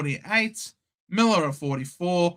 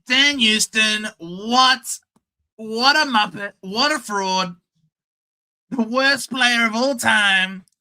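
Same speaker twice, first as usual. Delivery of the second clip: lightly voiced outraged mate, louder; mock rant impression; 3 semitones higher, 3 dB louder than usual. Mocking a fan's rant about Houston, lightly voiced. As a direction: ham up an outraged fan. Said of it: "That was such a terrible impression".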